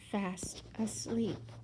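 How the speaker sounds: whispered slowly; hushed narration. A narrator's reading. Narrator tells children Goldilocks fell asleep, slow hushed whisper. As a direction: hush it, slow, as if not to wake her.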